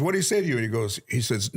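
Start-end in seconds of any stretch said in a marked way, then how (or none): 0.0-0.5 higher imitated Pesci, pressing; mock Pesci demanding an answer; Pesci's voice. Pesci asks Jack what the man said, higher imitation. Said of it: "those voices".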